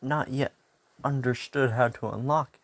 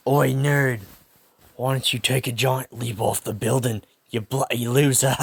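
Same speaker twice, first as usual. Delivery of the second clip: louder, sneering British-tinged bully; Bakugo taunting; 5 dB louder than usual. Acted. Bakugo taunts Izuku, loud sneering bully voice. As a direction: bark the insult like a school bully. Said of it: "I don't know why I made him sound like".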